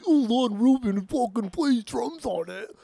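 high, slow mocking voice of co-host; sneering parody of a fan; mocking impression. Mocking his co-host's opinion in a high, slow voice.

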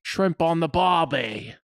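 put-on slow, drawn-out Australian accent. Joking about Australians, slow Australian accent. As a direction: put on a stock Aussie accent, slowly. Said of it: "the Australian accent is".

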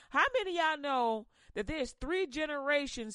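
ordinary delivery

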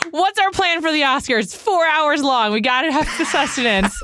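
high-pitched, hammed-up pleading; playful, exaggerated panic over planning; mock urgency. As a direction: replay your own urgent plea, half laughing by the end. Acts out own pre-show planning worry.

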